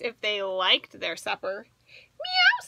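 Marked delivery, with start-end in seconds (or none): 1.9-2.7 high squeaky kitten meow. A narrator's reading. Reading to a child, a baby cat meows high and squeaky. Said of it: "said baby orange cat".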